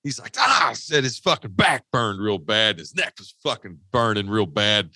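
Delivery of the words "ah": sharp high "Ah" yelp, loud; retold cry of sudden pain; mock yelp of pain. Friend feels the shot burning.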